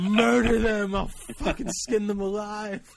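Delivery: high-pitched, overwrought doting-uncle threat; mock protective threat. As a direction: wail the threat, hammed up and overcome. Continues hammed-up protective uncle threat.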